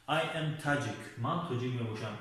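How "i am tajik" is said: slow model sentence. Shown as a demonstration.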